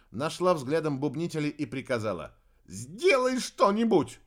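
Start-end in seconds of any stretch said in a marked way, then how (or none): 2.7-4.2 urgent high shout. A narrator's reading. Orders in brawl.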